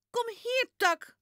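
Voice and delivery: high loud call to the dog. A narrator's reading. Girl calling the dog back loudly. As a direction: shout for the dog to come back. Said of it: "roept Janneke".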